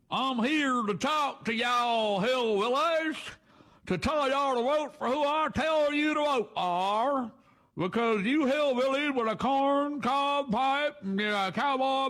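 high, slow, drawn-out Southern hillbilly drawl; hammed-up hillbilly twang, a politician pandering to country voters; mock hillbilly accent. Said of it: "Can you imagine if Trump went to Kentucky".

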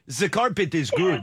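mock Egyptian accent, "The" as "zuh"; imitated Egyptian accent. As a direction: copy a thick Egyptian accent. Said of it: "probably the worst Egyptian accent".

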